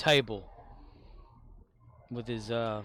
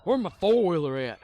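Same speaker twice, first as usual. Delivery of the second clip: put-on louder, higher slurred question from a confused drunk father; drunk dad's voice; 7 semitones higher, 10 dB louder than usual. Son retelling his drunk father asking for his wrecked four-wheeler, higher and louder. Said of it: "he says".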